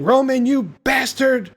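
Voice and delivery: high imitated cry of protest; playful retold shout; mock yell. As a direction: yell it high like a man being dragged. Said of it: "Chet starts yelling".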